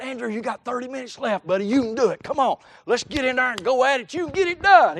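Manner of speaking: put-on rally a discouraged kid, pushing him on; retold pep talk.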